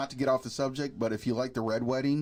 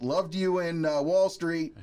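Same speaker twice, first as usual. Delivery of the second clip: mild mock shout, pitched up; Martin Sheen yell; 6 semitones higher, 4 dB louder, slower than usual. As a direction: call it out like a retold movie yell. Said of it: "Martin Sheen yells out".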